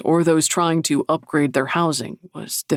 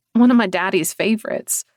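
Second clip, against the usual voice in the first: put-on southern accent; 4 semitones higher, 3 dB louder than usual. A narrator's reading. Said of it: "The hint of her southern accent was thicker".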